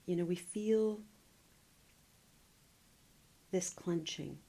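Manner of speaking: calm body-scan guiding with long pauses; guided meditation. Real, not acted. Meditation teacher leading listeners through a full body scan, calm guiding voice. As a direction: guide them gently inward, leaving long silences between phrases.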